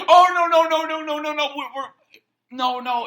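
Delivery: high, loud frantic string of no's; panicked denial. Retold or imitated. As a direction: deny it in a panic.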